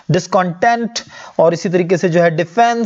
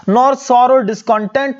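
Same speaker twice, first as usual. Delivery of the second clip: poem line read; 6 semitones higher, much slower than usual. Shown as a demonstration. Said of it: "यहाँ पर वो कह रहा है कि".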